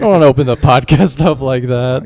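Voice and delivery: talking too loud for the mic. Real, not acted. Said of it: "You're a little loud".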